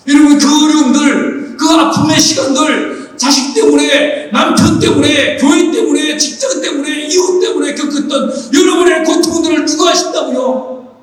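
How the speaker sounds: high, slow list building to a shout; preaching at full force. Acted. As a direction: pile up each hardship, then cry out the question.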